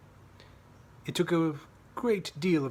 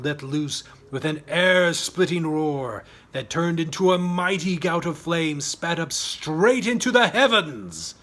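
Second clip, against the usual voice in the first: slowing and swelling louder toward the flame; building dramatic narration; 3 semitones higher, 8 dB louder than usual. A narrator's reading. Narrator describing the dragon's roar, building to a loud climax.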